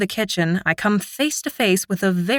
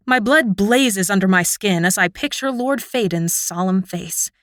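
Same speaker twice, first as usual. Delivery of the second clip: ordinary delivery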